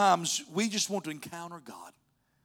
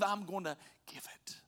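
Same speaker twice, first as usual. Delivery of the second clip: near-whispered, breath more than voice; hushed promise; 3 semitones higher, 7 dB quieter than usual. Acted. Preacher voicing Jesus's promise to the faithful, in a hush. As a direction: drop suddenly to a soft whisper.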